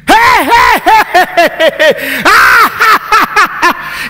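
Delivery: high, loud acted laughing fit; mocking laughter. Preacher acting a man laughing again at the devil, mocking laughter. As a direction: burst out laughing, hey-hey into ha-ha. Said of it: "he started laughing again".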